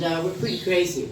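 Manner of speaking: normal talking voice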